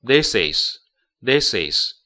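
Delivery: "this is" blended into one; linking this is. Shown as a demonstration. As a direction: run "this" straight into "is". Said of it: "This跟is要连读". ("this is"连读)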